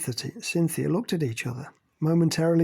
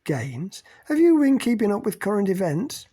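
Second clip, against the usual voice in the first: 1 scornful; 7 semitones higher, 5 dB louder than usual. A narrator's reading. Jessie mocking Cynthia.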